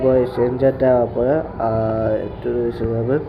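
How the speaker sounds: usual speaking voice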